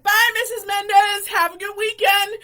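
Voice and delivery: re-enacted hollered goodbye; calling out. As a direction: call it across to a teacher. Calling out to teacher in story.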